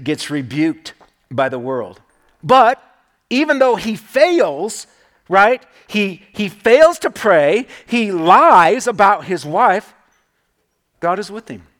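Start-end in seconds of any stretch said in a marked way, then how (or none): 2.4-9.9 slow, loud and deliberate, driving each point home; louder, slowed sermon emphasis; forceful preaching. Real, not acted. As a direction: preach it out slowly with weight.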